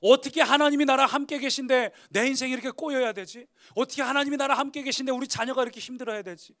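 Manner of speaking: loud, high outpouring of complaint; bitter lament. Acted. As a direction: pour out the grievance at God. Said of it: "탄식을 쏟아놓기 시작하는 겁니다".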